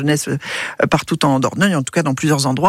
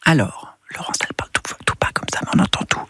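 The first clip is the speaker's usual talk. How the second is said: hushed whisper, mimicking the caller; library whisper; 5 semitones lower, 4 dB quieter, faster than usual. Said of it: "vous pouvez parler à haute voix".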